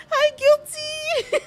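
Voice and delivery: very high, drawn-out squealed hello; giddy, stretched-out squeak; excited. Retold or imitated. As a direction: re-enact a starstruck fangirl greeting. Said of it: "I was so excited".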